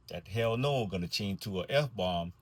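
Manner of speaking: ordinary delivery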